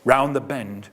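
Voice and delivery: plain reading voice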